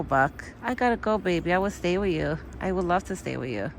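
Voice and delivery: ordinary talk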